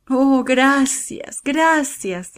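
high, drawn-out thanks; grateful relief. A narrator's reading. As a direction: stretch it out with heartfelt gratitude. Ana overjoyed, thanking the priest.

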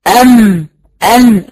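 أم then أن, each ending in a held, humming nasal; sakin letter demo. Shown as a demonstration. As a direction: press the lips for أم, the tongue for أن. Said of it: "أو مثلًا أن".

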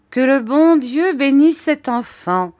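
high, loud exclamation; exclaiming. A narrator's reading. Miss Betsy exclaims over the naive mother, loud. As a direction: cry it out in exasperation. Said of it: "s'écria Miss Betsy".

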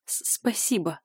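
whispered thanks, holding back tears; choked whisper. A narrator's reading. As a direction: squeeze the word out through tears. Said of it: "выдавливаю, подавляя новую порцию слёз".

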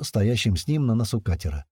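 usual speaking voice

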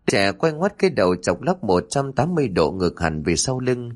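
ordinary delivery

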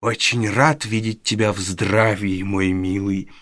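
old fence greets the boy in mock welcome; mock-courteous old man. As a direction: play the sly old fence bowing in jest. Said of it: "шутливо раскланялся старый еврей".

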